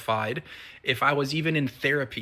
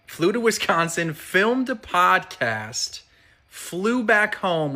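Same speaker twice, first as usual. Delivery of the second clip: higher and louder, podcast stretched slowly; proud excitement; 6 semitones higher, 3 dB louder, slower than usual. Real, not acted. Boasting to viewers about flying again, excited. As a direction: savor each achievement with a lift. Proud list of today's win.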